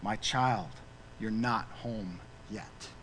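very soft, low, gentle voice of the Lord; tender divine reassurance. Retold or imitated. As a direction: console a weary servant, slowing on the last words. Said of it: "as if the Lord had put his hand on his shoulder and said".

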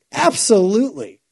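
eager acceptance. Acted. Bob eagerly accepts the invitation.

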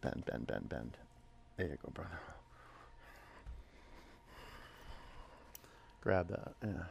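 low, quiet repeated cues; hushed coaching. Real, not acted. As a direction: coach one student quietly. Quiet coaching to one student.

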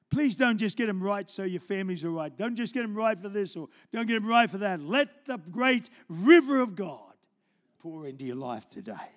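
loud, urging sermon delivery; fervent preaching. Acted. Preacher exhorting the congregation, loud and urgent. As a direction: drive it home to the church.

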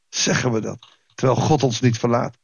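ordinary talk, nothing marked

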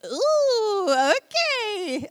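high, loud startled ooh; mock taken-aback reaction. Husband caught off guard by tears, startled ooh. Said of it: "he was like".

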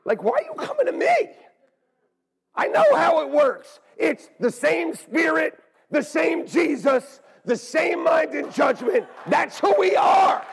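loud and high, slowing into a half-sung chant; preached retold shout. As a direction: build to a sung preaching cry. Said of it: "I go".

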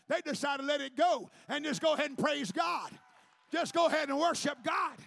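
rising shouted preaching, punching each go; preaching at full force. Acted. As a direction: build the sermon to a loud shout.

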